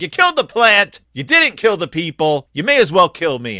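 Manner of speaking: loud, high angry shout; furious outburst. Retold or imitated. Jonah shouts at God over the dead plant. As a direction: yell it in bitter protest. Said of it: "And Jonah's like".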